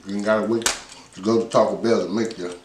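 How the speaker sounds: ordinary talk, nothing marked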